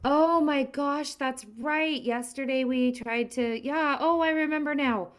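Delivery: high, excited gasp of remembering; delighted relief at remembering; sudden realization. Real, not acted. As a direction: it all comes back to you at once.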